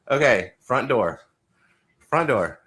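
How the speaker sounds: ordinary talk, nothing marked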